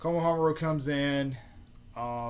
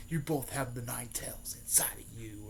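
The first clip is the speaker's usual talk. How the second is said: hushed, whispery retold villain line; whispered reveal; 5 dB quieter than usual. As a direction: let the secret out in a mock whisper. Villain reveals a hidden secret.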